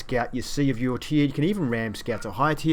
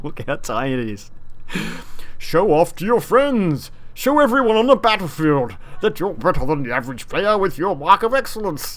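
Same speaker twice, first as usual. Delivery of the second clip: mock ad voice; 4 semitones higher, 6 dB louder than usual. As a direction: sell it like a cheesy TV ad. Mock ad pitch for tiny mark.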